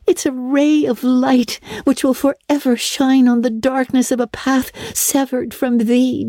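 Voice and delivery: put-on high, wondering girlish voice; Anne, romantic girl, moved. A narrator's reading. Anne learns that somebody loves her, moved and wondering. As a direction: marvel at it like a dreamy girl.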